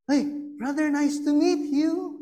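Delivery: lightly voiced husband greeting a brother sweetly; imitating nice husband. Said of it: "he is very nice".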